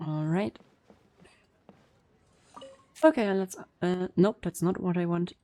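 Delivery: ordinary delivery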